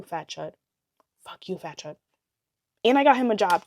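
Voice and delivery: ordinary delivery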